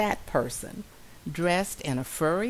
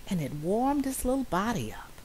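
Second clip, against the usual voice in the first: usual speaking voice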